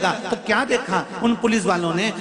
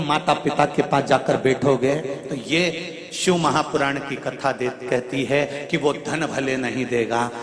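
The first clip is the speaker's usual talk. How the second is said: gentle sincere counsel; 7 semitones lower than usual. Acted. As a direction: advise them tenderly, lower. Lower, soft preaching close. (धीमे, कोमल स्वर में सच्ची सलाह)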